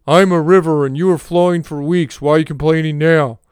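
a stern no-nonsense woman scolding, comic; Will Ferrell as Janet Reno. Acted. Talking river scolds the complainer, comic Janet Reno impression. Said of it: "sounds like Will Ferrell doing an impression of Janet Reno".

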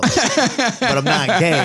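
slow, laughing punchline. Acted. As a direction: drag it out through a laugh. Punchline of joke bit.

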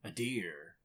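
ordinary talk

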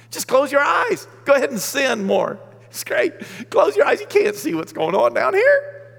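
high, loud mocking taunt; mock taunting shout. Preacher mocks sinner's hiding logic.

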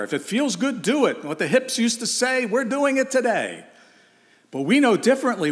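high pitched, fervent pulpit voice; mock the world's slogan, then answer it; impassioned preaching. Mocking worldly slogan, then rebuttal.

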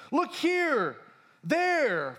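high, loud pointing cry; calling out. Acted. Preacher voicing people announcing the kingdom's arrival, calling out. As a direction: holler it out like a sighting.

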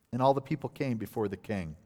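ordinary talk

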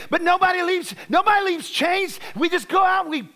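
full-force revival preaching; fiery preaching. Acted. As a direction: preach it hard to the congregation.

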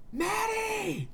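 high muffled call from afar; muffled cry. A narrator's reading. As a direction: call her name from outside, muffled. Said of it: "Theo's muffled voice cried from outside".

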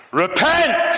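high, sudden street-preacher cry; yelled warning. Retold or imitated. Street preacher yelling at a passing boy, a high sudden cry. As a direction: fling it out as a doom warning. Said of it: "he suddenly yelled out".

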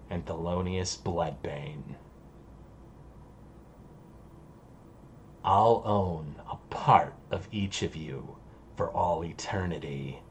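very low, hushed demon voice; demon's solemn farewell. Acted. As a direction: pronounce a dark promise softly and low. Game master plays the demon Anavel.

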